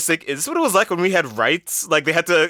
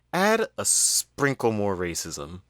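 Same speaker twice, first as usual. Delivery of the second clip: mock conspiratorial hush, lower and softer; sly mock suggestion; 5 semitones lower, 4 dB quieter than usual. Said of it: "at every turn is saying".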